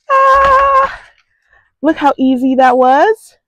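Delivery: high-pitched happy squeal; delighted squeal. Real, not acted. Squealing over easy gate.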